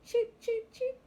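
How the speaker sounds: high, loud squeaky chick cries; chicks cheeping. A narrator's reading. Excited chicks shout over the wind, high cheeping.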